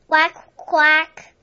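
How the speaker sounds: two loud quacks; loud duck calls. Acted.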